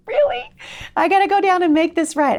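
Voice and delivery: high, loud mock protest; exasperated protest. Mom answering the Lord's nudge, high exasperated protest.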